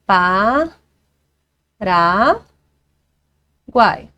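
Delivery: "pa, ra, guai" com pausas longas entre sílabas; divisão silábica. Shown as a demonstration. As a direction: separe "pa", "ra" e diga "guai" de uma vez. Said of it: "Três vogaizinhas ali na mesma sílaba". ("pa, ra, guai" silabado, o tritongo numa só sílaba)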